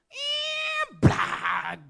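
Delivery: high yelp, then a loud "blag!" impact; crash sound effect. Retold or imitated. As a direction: mimic a car slamming into someone. Woman hit by a vehicle.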